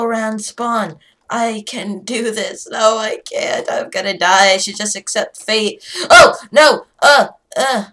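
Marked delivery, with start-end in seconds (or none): 6.1-7.9 loud, high cry of alarm; panicked shout. Real, not acted. Player about to die, panicked shout.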